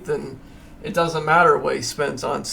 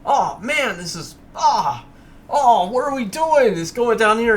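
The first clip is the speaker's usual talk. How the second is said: mock panic; 6 semitones higher than usual. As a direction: freak out as the price drops on the chart. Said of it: "and you're like".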